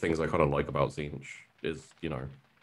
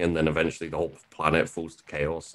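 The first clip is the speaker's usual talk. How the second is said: usual speaking voice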